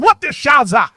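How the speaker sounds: bad German accent, quick jab; a goofy fake German accent. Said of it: "Is that your best German accent?".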